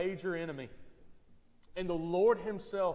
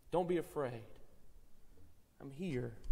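soft reassurance; 5 semitones lower, 7 dB quieter than usual. Retold or imitated. Pastor voicing Christ's comfort.

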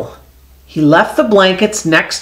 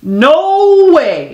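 farmer's refusal; 10 semitones higher than usual. Acted.

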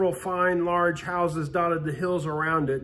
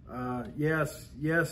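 stammering; 4 semitones lower, 7 dB quieter, much slower than usual. Acted. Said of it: "stammered John".

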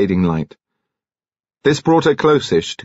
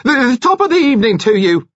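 very high, loud, stammered greeting; strained, artificial hallooing; unnatural shout; 5 dB louder than usual. A narrator's reading. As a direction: shout it far too brightly in a false high voice. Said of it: "shouted in an unnatural voice".

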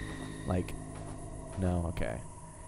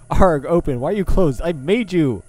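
loud, higher, hammed-up commanding; mock command; 8 semitones higher, 10 dB louder than usual. Player yells at her own locked door, mock commanding. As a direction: order the door open like an offended creator.

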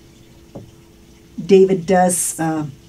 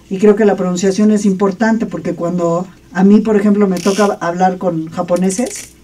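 ordinary delivery

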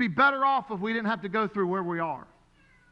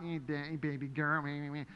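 a hint of kids' sing-song teasing, softer; mock teasing; 7 semitones lower, 9 dB quieter than usual. Classmates teasing his daughter, softly mocking in sing-song. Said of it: "joking with her all day long".

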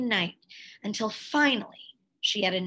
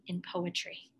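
whisper; 4 semitones lower, 8 dB quieter than usual. A narrator's reading. Climax of the Pulitzer win.

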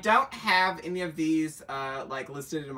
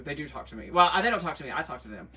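ordinary delivery

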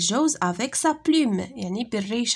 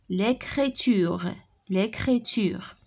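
slow model reading; slower than usual. Shown as a demonstration. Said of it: "lis les mots".

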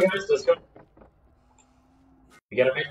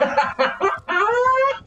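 loud high panicked laugh; screaming laugh; 3 dB louder than usual. Real, not acted. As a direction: laugh out in shrill shock. After the killer scare.

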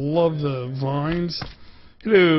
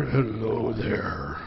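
lower, softer, very slow hello there; spooky low greeting; 6 semitones lower, 6 dB quieter, much slower than usual. Pretend. Meeting the guardian, slow low spooky hello.

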